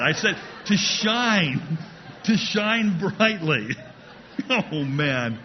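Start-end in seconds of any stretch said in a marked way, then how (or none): 0.0-3.7 slow, deliberate, leaning hard on "to" in "to shine"; correcting a joke, slow and pointed; slow correction, stress on to. Real, not acted. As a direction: spell out "to shine" slowly, punching "to". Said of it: "I did not say that".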